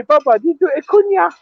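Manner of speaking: put-on high coaxing voice asking a small child; talking to a toddler. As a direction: coo it up high like to a baby. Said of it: "We say".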